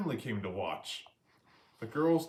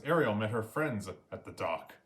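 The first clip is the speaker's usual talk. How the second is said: plain reading voice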